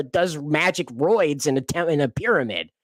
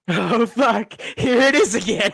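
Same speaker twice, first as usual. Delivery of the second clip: gleeful mimic of the clip; imitating the line; 6 semitones higher than usual. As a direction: parrot the actor's exasperated oh fuck. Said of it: "gotta have that for a ringtone".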